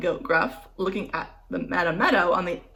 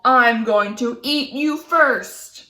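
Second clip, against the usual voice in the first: loud, slow troll growl; troll refusing the little goat, growling; 4 semitones higher, 5 dB louder, slower than usual. Acted.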